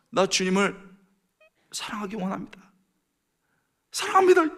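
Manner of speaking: high-pitched prayer rising to a shout; reenacted impassioned confession, bursting out at the end; fervent confession. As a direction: build to a loud, high cry of devotion. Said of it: "주님을 사랑한다고 고백하라고".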